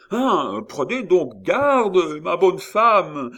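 high, loud, drawn-out protest; distressed shout. A narrator's reading. Friard crushed by the crowd, distressed shout. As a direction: cry out as if losing your head. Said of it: "cria Friard du ton de détresse".

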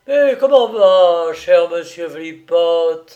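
jovial. A narrator's reading. John Robin greeting his captive old man, jovial. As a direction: hail him with hearty good cheer. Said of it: "dit John Robin d'un air jovial".

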